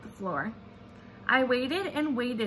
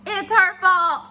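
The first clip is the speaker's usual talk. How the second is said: high, loud, slow shout; two girls shouting blame; 8 semitones higher, 10 dB louder, slower than usual. Acted. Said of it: "we shouted".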